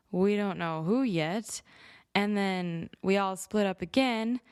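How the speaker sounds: ordinary talk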